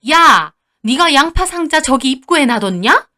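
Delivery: loud snap on the first word; mother-in-law's annoyed accusation; angry scolding. Acted. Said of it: "저한테 화를 내셨어요". (화내며 다그치는 시어머니)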